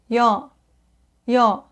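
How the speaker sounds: ordinary talk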